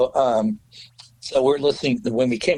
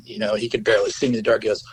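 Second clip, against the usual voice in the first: ordinary talk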